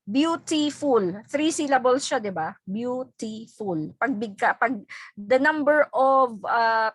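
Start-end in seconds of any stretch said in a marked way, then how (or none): 0.0-1.2 beautiful said slowly, each syllable apart; syllables spelled out. Shown as a demonstration. Teacher counts the syllables of beautiful slowly. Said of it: "Alam niyo yung syllable". 2.7-3.9 beautiful said slowly, each syllable apart; syllables spelled out. Shown as a demonstration. Teacher counts the syllables of beautiful slowly. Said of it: "Alam niyo yung syllable".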